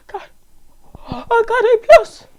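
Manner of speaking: high, loud triumphant squeal; hyped shout. Real, not acted. Gamer squealing over his A plus score. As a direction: squeal the win out. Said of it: "I'm sorry, man".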